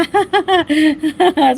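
bursts out laughing at the joke; a genuine amused laugh with a falling tail; real laugh. Real, not acted. Streamer laughing at a viewer's birthday-treat joke.

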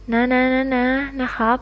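soft, wheedling, begging little pleas; coaxing. Acted. Coaxing Pon to give in, soft wheedling pleas. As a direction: coax him with soft repeated pleading. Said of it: "พี่เชนพยายามอ้อนผม".

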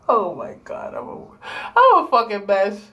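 normal talking voice

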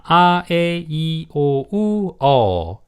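"아, 에, 이, 오, 우, 어" as separate held vowels; teacher sounding out "아, 에, 이, 오, 우, 어" one sustained vowel at a time; vowel sound demonstration. Shown as a demonstration. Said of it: "이렇게 소리가 나는 것들을 모음".

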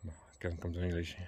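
ordinary talk

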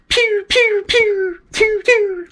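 very high, loud pew pew of bullets past the ear; a string of high bullet whizzes; bullets whizzing by. Shown as a demonstration. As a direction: shoot out squeaky pews like rounds flying by. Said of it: "It sounds like this".

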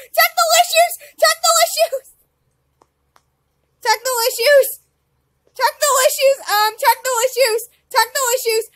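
high loud chanted alarm; repeated excited shouting. Real, not acted. Gamer announcing glitches to chat, high excited shouts. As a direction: keep shouting it over and over.

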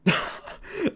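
breathy unvoiced burst of laughter. A narrator's reading. Friend bursting out laughing at the news on the phone, wheezy laugh. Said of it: "Sam burst out laughing again".

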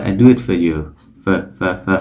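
"for" as a bare schwa, British English; British reduced for. Shown as a demonstration. Teacher demonstrating British English: schwa-only fuh. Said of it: "tiếng Anh Anh thì nó chỉ đơn giản là một âm schwa".

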